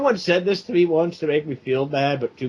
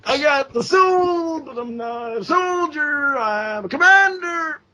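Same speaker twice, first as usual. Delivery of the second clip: shouted movie line; 12 semitones higher, slower than usual. Retold or imitated. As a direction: belt it out like a rock anthem. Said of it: "that scene from Southland Tales".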